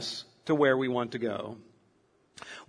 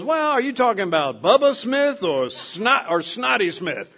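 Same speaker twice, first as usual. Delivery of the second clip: lightly voiced old codger, higher and louder; a hint of a helpful old codger; 6 semitones higher, 4 dB louder than usual. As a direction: play a country old-timer giving directions, lightly. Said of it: "you find some old codger".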